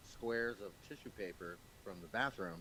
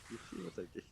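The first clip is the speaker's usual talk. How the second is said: put-on low, soft African accent echo; 3 semitones lower, 4 dB quieter, much faster than usual. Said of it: "genuine accent like that".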